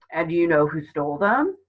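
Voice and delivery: lightly voiced amused policeman; gruff officer with a hint of amusement; smile in voice. A narrator's reading. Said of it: "could hear the smile in his voice".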